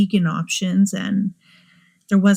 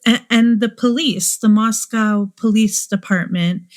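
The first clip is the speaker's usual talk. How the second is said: ordinary delivery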